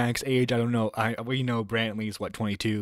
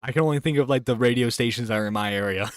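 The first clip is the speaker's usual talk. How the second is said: normal talking voice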